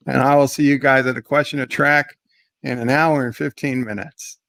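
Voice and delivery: usual speaking voice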